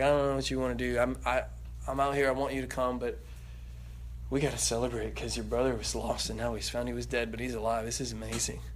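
soft, low, pleading invitation; tender father. Acted. Father quietly begging elder son to come celebrate, tender. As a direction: coax him in gently, full of joy.

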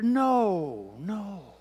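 normal talking voice

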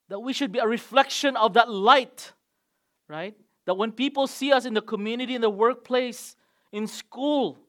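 loud, driving sermon voice; full-force preaching. Acted. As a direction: preach it to the whole congregation.

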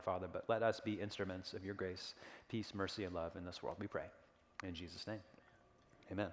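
holding back tears while praying; teary. Real, not acted. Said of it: "Sorry, getting a little, a little teary".

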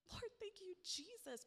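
high, overcome little prayer; relieved thanks. Retold or imitated. Believer told not to fear thanking Jesus, relieved. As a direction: sigh it upward in gratitude.

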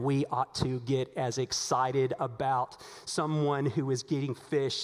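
ordinary delivery

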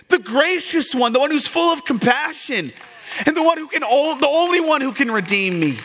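high, loud, impassioned pulpit delivery; full-force preaching. Acted. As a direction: preach it to the whole room at full voice.

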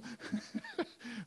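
soft real giggle; amused laugh. Real, not acted. Preacher recalling the family dinner, soft amused laugh.

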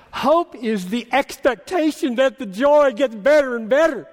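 high, loud, exultant pulpit delivery; emphatic, ringing proclamation; impassioned preaching. Real, not acted. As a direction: pour it out to the congregation with rising fervor. Preaches at full force.

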